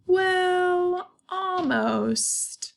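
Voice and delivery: long held high "well", sliding down; teasing, drawn-out hedge. A narrator's reading. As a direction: stretch each word for suspense. Comic twist ending of the story.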